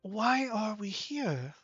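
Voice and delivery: lightly voiced tall stranger, bewildered; tall one. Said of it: "the tall one".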